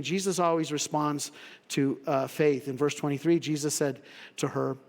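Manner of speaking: ordinary talk, nothing marked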